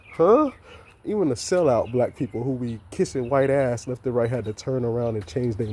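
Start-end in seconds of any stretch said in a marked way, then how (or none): 0.1-0.5 high, loud startled huh; shocked huh. Retold or imitated. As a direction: react like you can't believe what you heard. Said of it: "was like".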